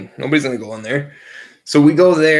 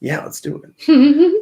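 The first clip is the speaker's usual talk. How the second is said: mock-eager yes, pitched way up, slow; eager agreement; 14 semitones higher, 5 dB louder, slower than usual. Friends plotting to steal a ramp, squeaky eager yes. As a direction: jump at the idea with put-on glee.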